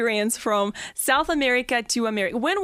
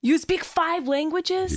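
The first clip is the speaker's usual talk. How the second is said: slow, higher, incredulous question; amazed disbelief; 6 semitones higher, slower than usual. Real, not acted. Podcast host, amazed by her guest's five languages, asks slowly and higher. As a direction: stretch it out in real disbelief.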